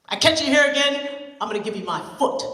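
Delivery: loud growled warning; loud threatening old man; gruff threat. Acted. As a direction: scare the kids off.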